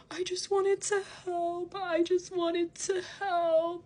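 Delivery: high-pitched, drawn-out fake crying wail; mock sobbing. Imitating her messaging back in tears, hammed-up mock sobbing. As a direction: mockingly imitate someone bawling. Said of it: "and she's crying, sobbing".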